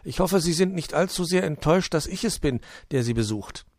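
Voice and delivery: high, loud little gnome voice; small high gnome voice; gnome Puh. Acted. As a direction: greet him as the shy gnome.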